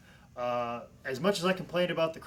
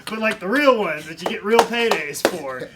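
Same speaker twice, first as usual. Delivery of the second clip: emphatic louder rant; 5 semitones higher, 11 dB louder than usual. Real, not acted. Self-mocking rant about real paydays.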